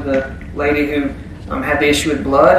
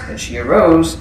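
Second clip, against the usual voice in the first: ordinary talk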